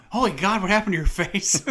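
loud, high movie-quote outburst; mock horrified shout. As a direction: shout it in hammed-up shock. Quotes Tommy Boy shocked outburst, laughing.

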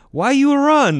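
squeaky, hammed-up Ukrainian impression; Ukrainian accent. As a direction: ham up the Ukrainian soldier's puzzled question. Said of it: "our Ukrainian counterpart that was attached to us".

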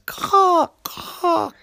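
high, loud crow cawing; a crow that can only caw. A narrator's reading. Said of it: "all the crow could say was".

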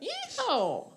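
high laughing cowboy whoop; cowboy yeehaw. Pretend. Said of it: "in that accent".

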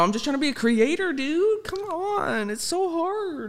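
whiny high voice. Pretend. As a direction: whine it like a spoiled kid.

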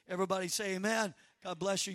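loud call to the congregation. Real, not acted. Pastor calling the congregation to say amen, loud. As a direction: rouse the room to answer.

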